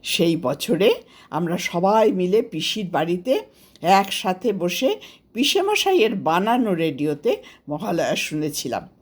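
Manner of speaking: plain reading voice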